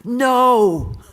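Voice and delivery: sudden high, loud shouted no; retold yell. Don leaps up in the restaurant and yells, loud. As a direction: bellow it so the whole room turns. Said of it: "he yelled out".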